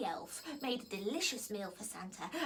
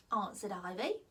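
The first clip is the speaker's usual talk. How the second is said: ordinary delivery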